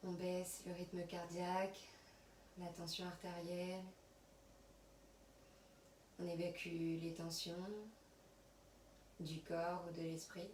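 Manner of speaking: soft, unhurried yoga nidra voice with long pauses; guided relaxation. Acted. Yoga teacher settling students on their mats, soft guided relaxation. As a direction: guide them quietly through body and breath.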